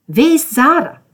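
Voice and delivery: high, loud yell; frustrated outburst; angry demand. Acted. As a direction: shout the question, demanding an answer. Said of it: "Georgia schrie weiterhin".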